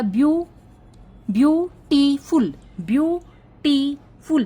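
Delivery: Beautiful slowly broken as view, टी, फुल, each beat loud; syllable by syllable. Shown as a demonstration. Teacher showing three syllables, beautiful chopped slowly into three stressed beats. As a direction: punch each part of beautiful with a pause after it. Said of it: "यहाँ पे कितनी बार रुकी मैं".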